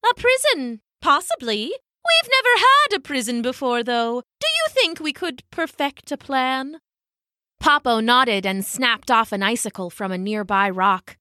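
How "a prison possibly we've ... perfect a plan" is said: high, doubtful elder penguin; high-pitched tentative questioning; Pippo. A narrator's reading. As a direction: mull it over in a fussy high voice. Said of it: "said Pippo".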